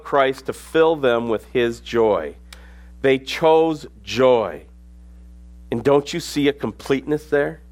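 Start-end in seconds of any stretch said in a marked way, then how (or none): 3.0-4.6 slow emphatic. Acted.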